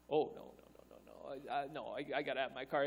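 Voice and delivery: low, hushed backpedalling; small-voiced refusal to give it up; reluctant protest. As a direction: back out quietly, stammering. Acted reluctant bargaining with God.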